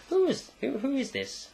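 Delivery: high, soft mock girlfriend voice. Girlfriend asking boyfriend about the stranger, high puzzled mock voice. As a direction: pitch it right up, softly puzzled.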